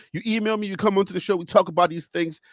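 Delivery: normal talking voice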